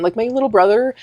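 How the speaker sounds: ordinary talk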